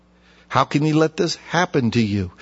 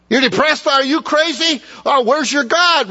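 much higher, incredulous sneer; mocking disbelief; 10 semitones higher than usual. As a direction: scoff in disbelief at the depressed. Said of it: "Must not have been saved".

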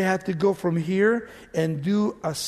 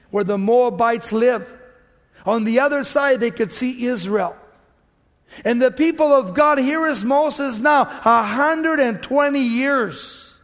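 high, loud, emphatic pulpit delivery; full-force preaching; 5 semitones higher, 6 dB louder than usual. Real, not acted. Preacher telling congregation of Israel nearing Jordan, preaching forcefully. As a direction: preach it out, stretching the key phrases.

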